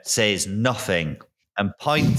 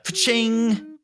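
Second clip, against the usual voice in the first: higher sound of a sword unsheathing; mouth-made sword unsheathing effect; 11 semitones higher than usual. Acted. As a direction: voice the ring of the blade.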